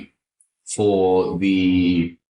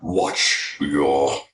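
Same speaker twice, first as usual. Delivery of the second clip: low, very slow, stern warrior father; Kratos impression; 5 semitones lower, much slower than usual. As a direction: drag it out deep like an angry god. Imitating Kratos scolding his son.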